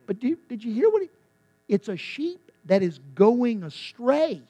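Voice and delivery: normal talking voice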